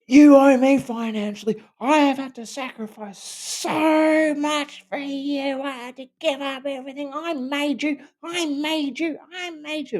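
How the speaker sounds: very high, demanding mock rant; a wife yelling demands over her husband, hammed-up; imitating a screaming wife. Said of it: "these screaming matches where she would be".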